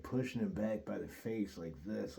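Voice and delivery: ordinary talk, nothing marked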